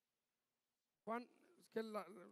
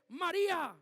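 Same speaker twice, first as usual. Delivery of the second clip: a strong call, louder and higher; 4 semitones higher, 9 dB louder than usual. Retold or imitated. Jesus calling Mary by the tomb, loud call. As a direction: call her name with force. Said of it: "Yo me imagino a María cuando le dice".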